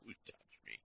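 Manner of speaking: soft, low "Who touched me?"; quiet and searching; whispered-style quote; hushed question. Acted. Jesus's question quoted softly.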